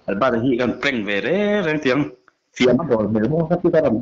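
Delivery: usual speaking voice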